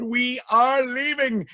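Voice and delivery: high, slow, drawn-out declaration; mock indignant cry. A narrator's reading. Townspeople quitting the kingdom, high indignant cry. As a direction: stretch each word in theatrical protest. Townspeople announce they are fleeing.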